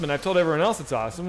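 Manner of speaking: ordinary talk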